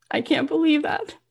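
high-pitched amazed voice; retold disbelief. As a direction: say it high, as if stunned by the news. She hears she sold out.

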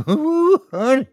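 high, gleeful whoop with a laugh. A narrator's reading. Ethan delighted as Laura hits him, high laughing whoop. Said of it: "he laughed".

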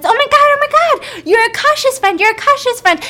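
put-on high, loud, breathless-to-tell kid voice; excited little kid. Little kid rushing up to Sophie, excited and high-pitched. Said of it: "the little kid says".

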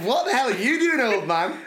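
high-pitched disbelieving exclamation; aghast at the old man, pitched well up; incredulous inner voice. Retold or imitated. Thinking to himself as Dad swigs wine, incredulous. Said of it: "I thought to myself".

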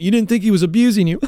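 ordinary delivery

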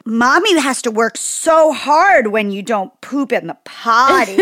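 put-on a smug toddler scolding mom, slowly and high; condescending toddler. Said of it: "condescending, shitty tone".

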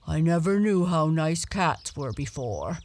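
the dog fondly telling the cat; mild fond dog voice; lightly voiced dog. Don speaks to Blackie.

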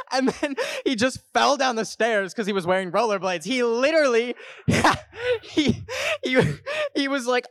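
high, giggle-broken telling; laughing while talking. Real, not acted. Host tells how he fell down the stairs, laughing. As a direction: fight real laughter to get the words out.

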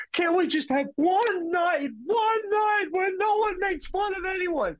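high, slow wailing mock plea; mother crying at the dinner table, high wailing plea; imitating crying mom. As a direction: wail it like a tearful mother pleading. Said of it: "my mom starts crying, and she goes".

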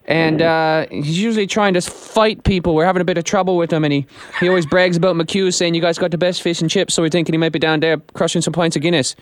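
lightly Irish prank caller voice; Irish accent. Pretend. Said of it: "Jim is gonna put on his best Irish accent".

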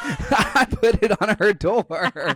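laughing through the line, slowed. Real, not acted. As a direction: giggle while telling it. Laughing retelling her prank.

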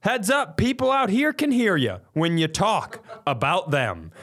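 high sharp heads-up; high retold scolding; retold callout. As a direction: call through the door, high and pointed. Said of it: "I go".

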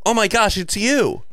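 high-pitched pretend fan greeting; imitation of an excited fan; mock starstruck. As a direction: play gushing over a celebrity. Imagined starstruck greeting of celebrity.

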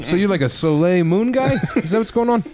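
mock Rogan. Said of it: "He thought I was Punky Brewster".

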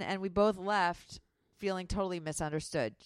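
ordinary talk

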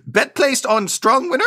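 high, slow put-on bookie voice; a squeaky-high NPC clerk, slowing down; booking agent voice. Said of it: "and he says".

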